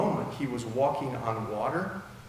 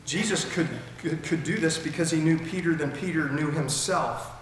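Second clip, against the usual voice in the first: ordinary delivery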